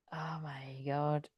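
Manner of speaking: real slow whispered shock; stunned whisper. Real, not acted. Reader just realising something about a song, stunned whisper. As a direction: whisper it slowly as it dawns on you. Sudden overwhelming realisation mid-reading.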